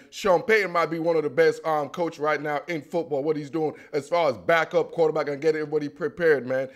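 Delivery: ordinary delivery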